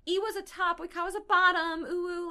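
high-pitched mocking fan voice. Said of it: "they're like".

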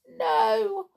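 high, thin toy clown voice; Clockwork Clown voice. Acted. Clown answering Tiptoe from his bed, high toy voice. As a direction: play the sad little clockwork clown.

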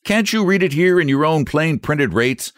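red-faced man losing his temper; furious customer. Acted. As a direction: jab every question at him. Furious customer argues rates.

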